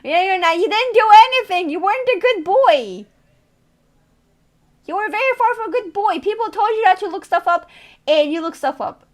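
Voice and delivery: high, loud playful telling-off; mock scolding. Streamer tells viewer he wasn't a good boy, mock-indignant.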